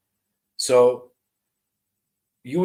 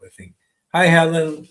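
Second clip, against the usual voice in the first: ordinary delivery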